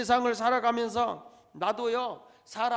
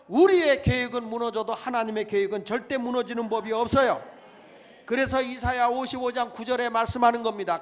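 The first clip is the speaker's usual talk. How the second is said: loud, ringing sermon delivery; preaching; 4 semitones higher, 7 dB louder than usual. Acted. Pastor exhorting his congregation, preaching at full force. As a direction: preach it out to the whole church.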